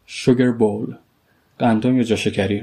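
usual speaking voice

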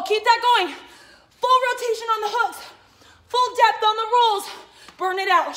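loud, high coaching shout; shouting orders over battle. Real, not acted. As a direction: push them through the burnout. Burnout round, coach pushing hard.

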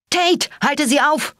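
high, stretched urgent cry; contained high-pitched scream; screamed command. A narrator's reading. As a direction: yell the order in alarm, pitch way up. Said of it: "schrie ich".

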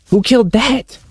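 very high, squeaky questions; incredulous, high-pitched outburst; alarmed disbelief. Real, not acted. Startled by more kills.

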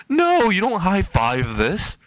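high, drawn-out protest; mock-indignant high voice; acted outrage. Bakugo objects to the high five.

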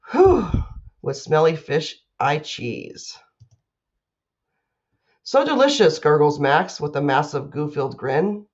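put-on lively picture-book reading for kids; reading to children. A narrator's reading. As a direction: read it to a class like a playful storyteller. Teacher reads picture book aloud.

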